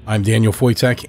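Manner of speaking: ordinary delivery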